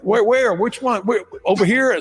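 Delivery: high, rapid-fire retold questions; flustered driver.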